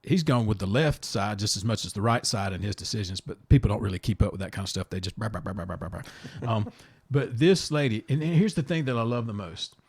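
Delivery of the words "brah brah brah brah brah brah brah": low, soft string of brah-brah noises; mock blabbering. Host mimicking people who don't keep up, mock blabbering. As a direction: babble it dismissively under your breath.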